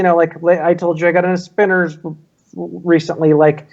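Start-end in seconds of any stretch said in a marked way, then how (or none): none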